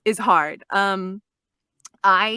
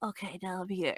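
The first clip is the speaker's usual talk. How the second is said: very soft, slightly lowered character voice; Hachimitsu; 11 dB quieter than usual. Shown as a demonstration. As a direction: settle quietly into a subdued character, just after yawning. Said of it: "that's how you get into the voice".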